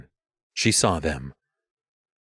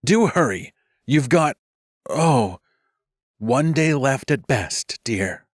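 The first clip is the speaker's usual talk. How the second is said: called out; 6 semitones higher, slower than usual. Acted. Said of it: "called across the circle".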